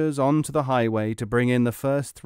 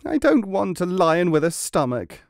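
indignant refusal; 7 semitones higher, 4 dB louder than usual. A narrator's reading. Client refuses again.

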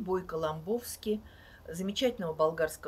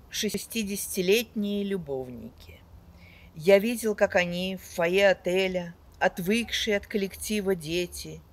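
loud, sweeping poetry recital with big swells; dramatic poetry declamation; 6 dB louder than usual. Acted. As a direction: declaim each line like on stage. Reads poems aloud to audience.

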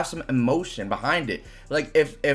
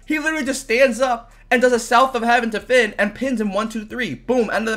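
excited rant; 9 semitones higher, 4 dB louder than usual. Real, not acted. Wrestling fan ranting to viewers, excited and loud. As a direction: pitch jumps up, louder, worked-up disbelief. Worked up rant about the match.

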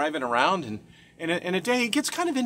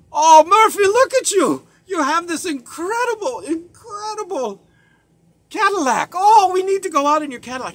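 very high, loud, over-the-top raving; gushing excitement; 12 semitones higher, 12 dB louder than usual. Retold or imitated. Business partner on a motorcycle fawning over Murphy's Cadillac. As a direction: rave like the car is a miracle.

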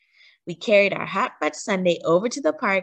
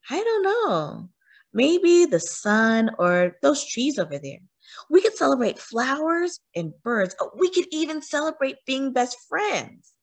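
dreamy excitement; 7 semitones higher than usual. A narrator's reading. Melina dreaming up celebrations.

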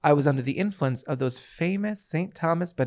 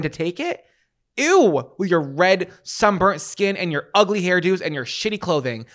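indignant venting with pitch jumping up; pissed-off rant; 3 semitones higher than usual. Real, not acted. Cruise guest venting about judgmental passengers, pissed off. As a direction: rant it out, punching the outraged words. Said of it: "I am so pissed about this".

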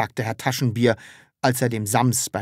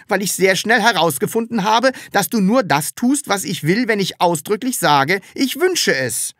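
high, loud, emphatic character voice; insistent little creature explaining; Sams justifying itself; 9 semitones higher, 5 dB louder than usual. Acted. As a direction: argue back, pitched well up.

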